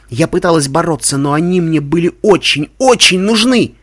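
narration climbing high and loud; overwrought, mounting intensity; desperate. A narrator's reading. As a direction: build urgently to an anguished peak. Narrator desperately craving the secrets.

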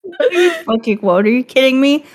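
normal talking voice

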